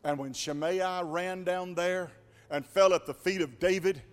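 ordinary talk, nothing marked

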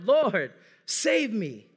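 slow, stretched-out plea to God; drawn-out plea. Acted. Preacher voicing the congregation's cry, slow, stretched plea. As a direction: draw the words out like a long plea. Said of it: "we're screaming out".

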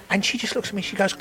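ordinary talk

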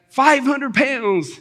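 high, drawn-out crowd cheer at moderate volume; slow, high mock yell; mock excited cry. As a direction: imitate the thrilled crowd, stretching it out. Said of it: "they were all excited and screaming".

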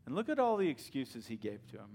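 normal talking voice

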